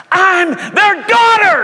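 high, drawn-out full-voice shout; screamed out loud. Retold or imitated. Adopted girl to her friend as it hits her, screamed out loud. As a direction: cry it out slowly at the top of the voice. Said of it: "She shouted and screamed out loud".

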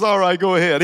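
loud, imitated concession; imitated grudging go-ahead. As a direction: give in out loud, as the passenger did. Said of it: "He said it just like that".

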